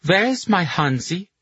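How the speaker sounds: high, slow, bitter demand; a jilted tipsy woman demanding; scorned drunk woman. A narrator's reading. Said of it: "set her jaw for a woman scorned".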